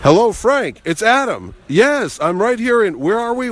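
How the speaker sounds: high, hammed-up phone greeting; Adam West. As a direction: imitate a star hailing an old pal by phone. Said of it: "and he goes, Frank".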